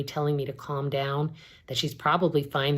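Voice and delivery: ordinary delivery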